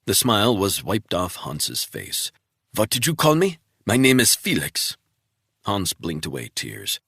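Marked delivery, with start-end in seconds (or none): 2.7-5.0 higher, caught-out protest; spy startled at hearing his real name, protesting; startled denial. Acted. Captive startled.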